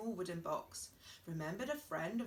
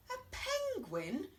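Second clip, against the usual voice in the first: much higher, slow horrified gasp; dismayed cry; 11 semitones higher, slower than usual. Acted. Hen told her baby is a penguin, high dismayed cry. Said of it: "cried Chicken".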